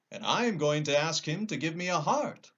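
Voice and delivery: a kind metal man suggesting; lightly voiced tin woodman.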